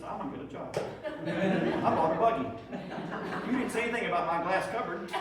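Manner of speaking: quoting the wife through laughter; laughing quote. Retold or imitated. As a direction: laugh while voicing her proud, cheeky words. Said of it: "she says".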